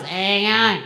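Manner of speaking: loud nasal Aussie, one sound; nasal Australian accent. Shown as a demonstration. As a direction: push the Australian greeting through the nose. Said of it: "Further in the nose".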